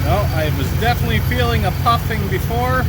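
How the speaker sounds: talking loud from under a car; lying under a car. Real, not acted. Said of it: "I'm under the car".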